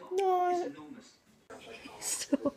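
laughing shrieks while tickled; being tickled. Real, not acted. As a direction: squeal stop between giggles. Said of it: "Don't tickle me".

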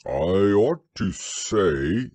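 slow, loud, growly bear; explaining bear. A narrator's reading. Pooh explaining about his boat, growly. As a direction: begin a weighty explanation, drawn out. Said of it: "explained Pooh".

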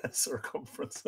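quiet laughing repeat of a pun; amused, under the laughter. Real, not acted. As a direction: chuckle through the word, voice dropping away.

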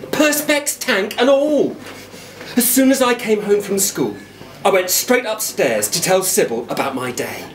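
ordinary delivery, nothing marked

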